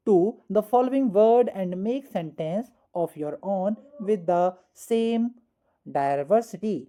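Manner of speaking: very slow, word-by-word reading of the instruction; slow dictation. Shown as a demonstration. Teacher reading the exercise instruction slowly to students. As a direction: spell it out slowly for the class.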